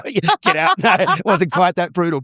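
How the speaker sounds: high-pitched, laughing through the words. Real, not acted. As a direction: crack up at your own joke while talking. Jokes about being rejected, laughing.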